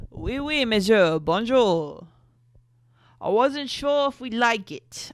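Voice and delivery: high, loud, hammed-up French accent; horrible French accent. Woman hosting a French dinner, horrible French accent. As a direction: overdo a bad French accent, pitching it up. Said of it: "switched over to my horrible French accent".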